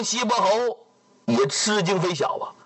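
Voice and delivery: usual speaking voice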